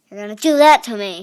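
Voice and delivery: loud fast outraged accusation; loud rapid protest at the rival; indignant shout. Real, not acted. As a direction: fire it back fast and loud.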